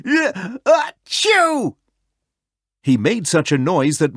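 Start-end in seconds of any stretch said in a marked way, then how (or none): 0.1-1.8 huge sneeze. A narrator's reading. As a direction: let the sneeze burst out. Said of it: "he let out a huge sneeze".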